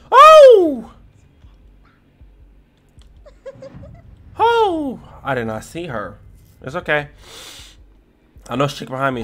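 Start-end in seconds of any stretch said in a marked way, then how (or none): 0.1-5.0 high shriek of fright; scream, startled. Real, not acted. Jump scare.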